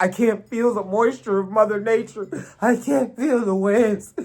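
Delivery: mock bawling Storm. Storm wailing after losing her powers, mock crying. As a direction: ham up a sobbing Storm. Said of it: "She's bawling tears".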